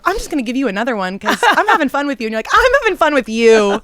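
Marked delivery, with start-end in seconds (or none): 0.1-2.3 high, loud, quick and friendly; upbeat audition-room chumminess; chummy casting person. Retold or imitated. 2.5-3.8 loud, high, gushing reply; hammed-up delight at the casting room; over-eager actor. Acts the actor eagerly echoing back.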